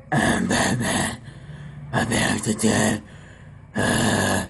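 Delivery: low, slow Batman growl; Dark Knight Batman. Acted. [name] doing Batman for his viewers, low slow growl. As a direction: grind out the line like the Dark Knight. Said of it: "My first impression is going to be Batman".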